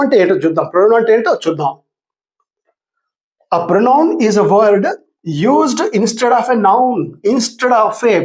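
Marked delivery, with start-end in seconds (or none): 3.5-8.2 very slow, word by word for writing; teacher dictating a definition; slow dictation. Shown as a demonstration. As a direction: spell it out slowly for the class to copy. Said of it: "please write down".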